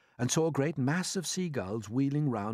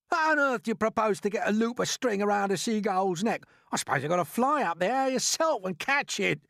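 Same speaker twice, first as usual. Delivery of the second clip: scornful earthworm; 11 semitones higher, 6 dB louder than usual. A narrator's reading. Earthworm scoffing.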